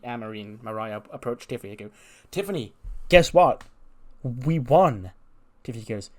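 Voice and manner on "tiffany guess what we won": higher, louder gushing; eager news. Retold or imitated. Anne Marie and Mariah telling Tiffany they won, excited. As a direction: burst in with the good news. Tag partners rush to Tiffany.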